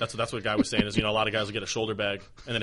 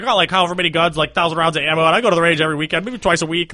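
excited quote; 7 semitones higher, 8 dB louder than usual. Retold or imitated. Quoting the gun-obsessed brother.

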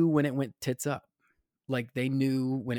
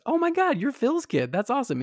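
high, louder excited exclamation; delighted recognition; 13 semitones higher, 5 dB louder than usual. Retold or imitated. Star meeting an old friend's kid, delighted. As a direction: burst out in delighted surprise.

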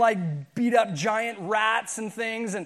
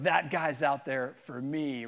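low, soft, slow mock longing; a hint of a smitten girl; dreamy girl; 7 semitones lower, 4 dB quieter, slower than usual. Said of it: "every girl goes".

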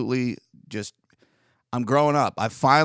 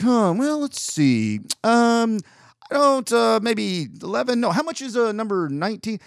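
dithering lottery customer; 9 semitones higher, 3 dB louder than usual. Retold or imitated. Customer at the counter picking lottery tickets, dithering. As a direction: mimic an indecisive customer pondering aloud. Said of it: "dude just starts looking at the, the tickets".

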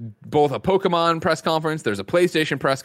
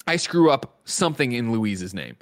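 ordinary delivery

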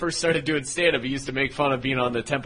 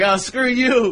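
dismissive jock voice; 6 semitones higher, 4 dB louder, slower than usual. Retold or imitated. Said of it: "it's like".

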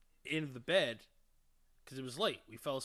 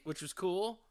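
ordinary delivery

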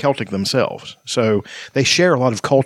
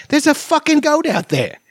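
put-on much higher Scottish kid voice, hamming it up; Scottish accent, high child's voice, "fucking" said in full. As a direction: play a cheeky little Scottish child saying "fucking" plainly as an English swear. Quoting Scottish kids cussing.

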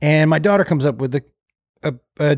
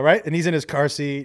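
usual speaking voice

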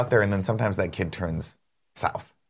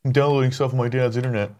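a lightly voiced Andy, casual; a hint of Andy bragging from home; Andy impression; 3 semitones higher than usual. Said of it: "that's a good Andy impression".

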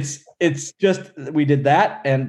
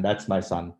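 imitating Kali, softer proud dad; 7 semitones lower, 8 dB quieter than usual. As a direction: lower and softer proud-father echo. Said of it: "And he goes".

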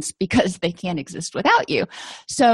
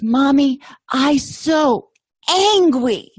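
put-on little toddler voice; 5 semitones higher, much slower than usual. Mother retells her three-year-old son telling her, in a high toddler voice. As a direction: play a tiny boy announcing he's angry. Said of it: "in his little toddler voice".

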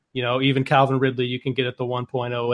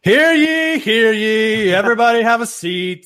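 loud, high proclaiming "hear ye"; town crier; 7 semitones higher, 4 dB louder, slower than usual. Acted. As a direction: call the court to order like a crier. Opening the mock court show.

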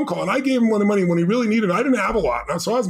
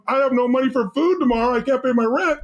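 higher-pitched imitation of panicked self-talk; mock freak-out; 6 semitones higher than usual. Retelling the worry he never felt, mildly panicky. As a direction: act out a mild money panic. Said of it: "I should have been freaking out going".

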